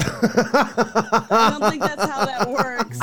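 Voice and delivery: loud words broken up by laughter; laughing too hard to argue; real laughing disbelief. Real, not acted. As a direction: object while helplessly cracking up. Cracking up at absurd claim.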